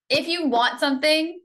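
ordinary delivery

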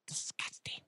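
very soft, voiceless disgust; hushed, revolted repeat; disgusted whisper. Real, not acted. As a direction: whisper it with revulsion.

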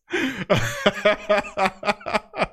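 long delighted laugh; real laugh. Real, not acted. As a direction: laugh it out, happy and loose. Laughing while greeting the chat.

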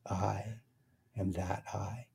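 very soft "I", pause, then "am that I"; stress on first "I". Shown as a demonstration. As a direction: set the first "I" apart, barely voiced. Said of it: "go to the emphasis of the words".

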